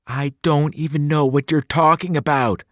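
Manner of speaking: ordinary delivery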